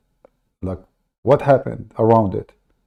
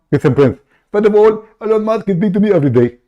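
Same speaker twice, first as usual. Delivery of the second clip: raised, pushy put-on caller voice; fake caller voice; 8 semitones higher, 5 dB louder, faster than usual. Prank caller cutting into the host's show, high put-on voice. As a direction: barge in as an eager fake caller.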